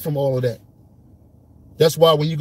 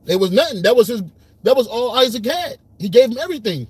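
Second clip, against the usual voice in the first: emphatic retelling; 7 semitones higher, 4 dB louder than usual. Real, not acted. Excited retelling of the story.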